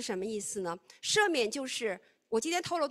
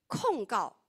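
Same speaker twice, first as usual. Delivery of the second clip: ordinary talk, nothing marked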